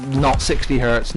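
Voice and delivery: ordinary talk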